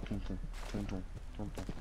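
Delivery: hushed heartbeat. Acted.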